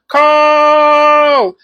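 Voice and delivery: high loud drawn-out call of a name; excited call. Real, not acted. Calling her friend Carl's name again, high and loud. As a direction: hail your friend across the room.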